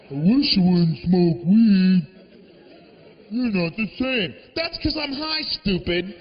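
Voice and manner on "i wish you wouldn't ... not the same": drawn-out, loud whiny "I wish"; mock the dog's sappy plea, slowly. Comic mocks the commercial dog's plea, whiny and slow.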